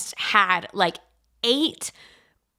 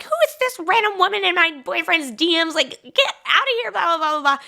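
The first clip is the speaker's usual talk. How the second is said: high, indignant mock rant; a hammed-up gripe, rising at the end; mock outraged rant; 8 semitones higher than usual. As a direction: play up the girls' outrage over the DMs. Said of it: "we're like".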